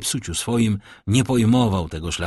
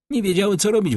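plain reading voice

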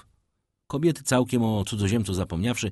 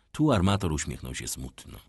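usual speaking voice

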